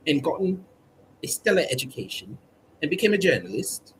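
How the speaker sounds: a lightly put-on British accent, proud and prim; mock posh British. Comedian as proud British subject, mock posh accent. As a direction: hold the prim royal-loving Brit voice.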